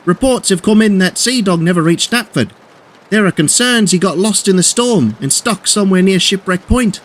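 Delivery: high-pitched alarmed report; urgent cry. A narrator's reading. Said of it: "cried a worker".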